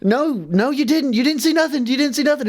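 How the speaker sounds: high, insistent imitation; mock frantic denial. Customer tells clerk who saw the drop, frantic denial.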